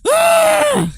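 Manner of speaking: high, loud mocking screech; imitating the streamer screeching at her, high reeing scream. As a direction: let out a shrill rage screech like a tantrum. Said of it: "He's just, like, reeing at her".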